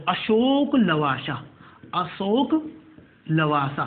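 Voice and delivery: "Ashok Lavasa" very slowly, vowels stretched; slow dictation. Shown as a demonstration. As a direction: draw out "Ashok Lavasa" so students can write it. Repeating the name slowly for dictation.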